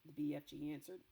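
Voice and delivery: plain reading voice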